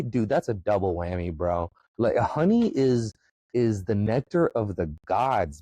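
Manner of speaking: ordinary talk, nothing marked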